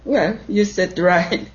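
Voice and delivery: normal talking voice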